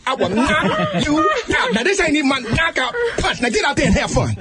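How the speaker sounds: high, half-laughing mother's threats; hammed-up threat to a back-talking kid; threatening mother.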